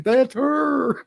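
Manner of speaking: high, wailing imitation of sobbing; mock crying wail. Husband recognizing his wife under the sheet, mock wailing cry.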